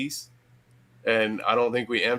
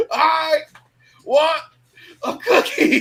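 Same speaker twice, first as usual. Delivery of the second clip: much higher, whiny crybaby wail, half laughing; mock crying plea; 7 dB louder, slower than usual. Stoned friend Billy sobbing for a cookie, mock whine. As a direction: wail it like a stoned kid begging, cracking up. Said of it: "He's crying".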